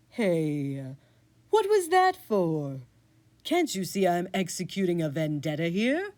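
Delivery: a cat caught in the act, offended; huffy talking cat; indignant cat. Acted. GM voices the cat.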